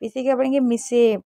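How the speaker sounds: ordinary talk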